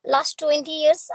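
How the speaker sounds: ordinary talk, nothing marked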